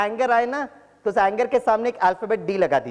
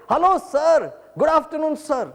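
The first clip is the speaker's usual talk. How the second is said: excited greeting; 10 semitones higher, much slower than usual. Retold or imitated. Former student spotting teacher in a garden. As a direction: hail him from afar gladly.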